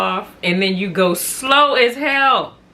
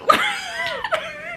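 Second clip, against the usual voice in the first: high laughing shriek. Real, not acted. Mocking tailgater, laughing.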